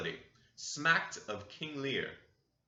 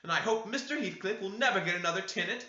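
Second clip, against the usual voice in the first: high, raised and cutting curse; sharp, much higher voice; sharp answer; 9 semitones higher, 4 dB louder than usual. A narrator's reading. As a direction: spit the curse at them. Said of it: "she answered sharply".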